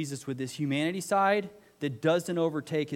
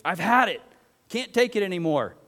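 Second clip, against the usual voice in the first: higher, louder fed-up outburst; exasperated parent yell; 6 semitones higher, 9 dB louder than usual. Retold or imitated. Pastor mimics a parent yelling at their kids, exasperated and loud. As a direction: blow up like a parent at the end of their rope. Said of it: "We've done this to our kids".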